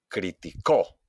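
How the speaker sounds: "criticó" punched on the last CÓ; stress on final syllable. Shown as a demonstration. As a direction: land hard on CÓ in "criticó". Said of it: "hago un golpe de voz". ("criticó" con golpe de voz en CÓ)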